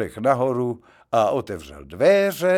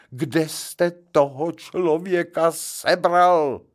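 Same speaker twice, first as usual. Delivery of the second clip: slow, mildly raised angry yell; 6 semitones higher, 3 dB louder, slower than usual. Acted. Gentleman keeps yelling at the chauffeur, mildly. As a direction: keep bawling at the driver. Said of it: "řval".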